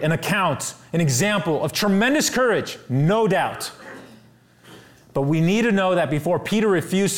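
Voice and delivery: climbing sermon intensity; fiery preaching. Acted. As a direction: drive it home from the pulpit. Preacher building to full force.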